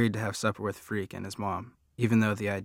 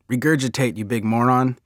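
higher, louder bossy shout; shouted order; 3 semitones higher, 6 dB louder than usual. A narrator's reading. Freak shouting at his choking friend to cough it up. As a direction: shout the insult as an urgent command. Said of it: "Freak shouts out".

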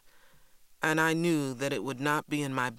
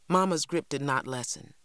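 plain reading voice

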